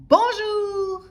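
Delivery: high, loud drawn-out holler; yelled greeting. A narrator's reading. Noisy townsfolk meeting in the street, yelling. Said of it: "ils hurlaient".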